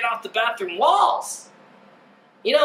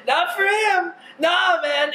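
normal talking voice

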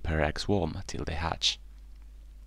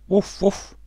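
high, loud woofs; two sharp, pitched-up barks; imitated dog bark; 7 dB louder than usual. A narrator's reading.

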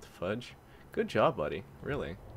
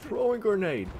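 grenade warning yell; 10 semitones higher, 5 dB louder than usual. Retold or imitated. Echoing game voice line.

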